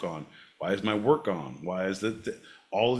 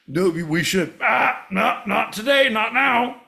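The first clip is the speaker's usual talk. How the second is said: much higher and louder, a playful whine; mock whiny refusal; 12 semitones higher, 9 dB louder than usual. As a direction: refuse like a spoiled kid, half-joking. Mock refusal after laugh.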